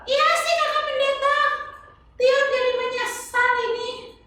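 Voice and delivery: put-on high, sing-song little girl voice; chirpy kid puppet answering the pastor; high child puppet voice. Tiur tells the children the lesson.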